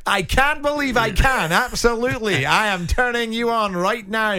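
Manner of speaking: high, loud, hammed-up Scottish accent. Shown as a demonstration. Co-host asked for an accent, exaggerated Scottish. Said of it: "Can you do a Scottish accent".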